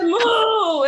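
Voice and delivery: a loud, high, laughing moo back at grandma; mock cow moo. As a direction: moo at her like a cow, cracking up. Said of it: "you sound like a cow".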